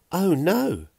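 high, loud squeal of alarm. A narrator's reading. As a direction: squeal it out in sudden dismay. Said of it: "Fiona squealed".

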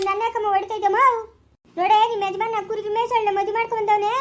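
ordinary delivery

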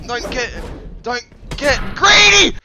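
loud, high yell of Don't get greedy; loud warning. Real, not acted. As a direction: bark it at yourself mid-fight. Warning himself mid fight.

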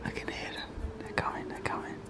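tense hushed whisper. Real, not acted. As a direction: breathe it out, scared of being heard. Hiding from staff at night.

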